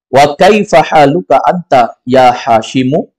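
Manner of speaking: slow, clear Arabic question; quoted question. Shown as a demonstration. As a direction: read the teacher's question slowly. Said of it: "Kata dia apa?". (pertanyaan dibaca pelan)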